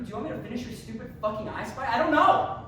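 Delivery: venting at his absent dad, rising into a yell; frustrated outburst. Acted. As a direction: let the frustration boil over into a shout.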